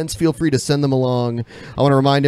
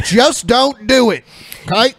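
mock Just Say No ad; 10 semitones higher, 5 dB louder than usual. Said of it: "Just Say No campaign for leakers".

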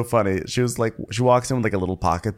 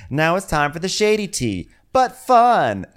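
high lilt, louder on the ending; mock hostess announcement; 7 semitones higher, 3 dB louder than usual. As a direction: imitate a hostess putting on forced fun. Kenya announcing next game.

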